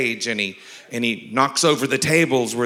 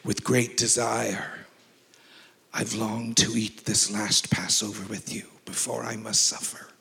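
whispered Last Supper line; 3 semitones lower, 8 dB quieter than usual. Retold or imitated. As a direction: breathe it like a sorrowful confession. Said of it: "and I would say".

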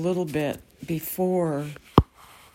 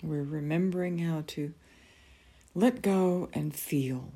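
guided meditation. Acted. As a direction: ease them gently into calm.

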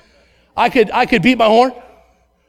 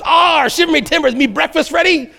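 a hint of a pirate, higher; mock pirate; 6 semitones higher than usual. Pastor imagines himself coming down the steps, in a mock pirate voice. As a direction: pretend to greet the morning like a pirate. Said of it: "my wife and I, saying those words".

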